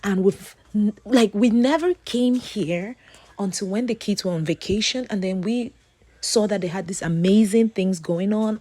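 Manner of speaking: ordinary talk, nothing marked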